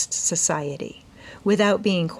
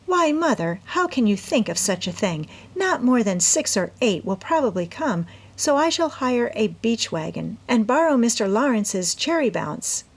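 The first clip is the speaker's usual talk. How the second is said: put-on young girl's voice, pitched higher, earnest; eager young woman explaining her plan to her mother; Amy's voice; 4 semitones higher than usual. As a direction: plead your case brightly as the eager daughter. Amy protests.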